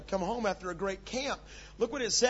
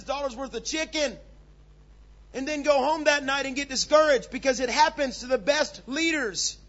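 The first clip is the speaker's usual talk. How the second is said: loud, high, baffled cry; preacher exasperated at Jonah's anger, shouting; incredulous preaching; 8 semitones higher, 7 dB louder than usual. Real, not acted. Preacher rising to full force.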